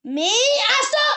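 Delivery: high, loud scolding shout; real shouting. Real, not acted. Owner stopping a misbehaving puppy, real shout. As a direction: yell at the dog to stop. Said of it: "Sorry, παιδιά, φωνάζω".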